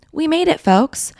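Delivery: high, triumphant we made it; excited. Real, not acted. Host cheering listeners through January, high and triumphant. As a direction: cheer it like a finish line.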